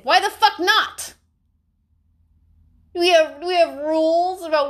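high, loud indignant snap; indignant outburst. Real, not acted. Answering critics with a loud indignant snap. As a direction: fire it straight back at them.

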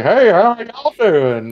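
high-pitched mock Southern accent; Texas accent. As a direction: put on a big Texas "y'all" greeting. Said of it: "a Texas accent or a Southern accent".

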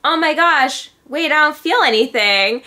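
high, loud burst of surprise; surprised waking up. Retold or imitated. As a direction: gasp it out, amazed nothing hurts. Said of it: "I was like".

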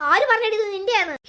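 ordinary talk, nothing marked

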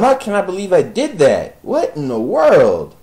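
frustrated outburst; exasperated. Real, not acted. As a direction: yell at your own mistake. Exasperated at own blunder.

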